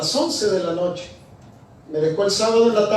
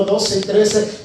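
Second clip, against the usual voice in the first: normal talking voice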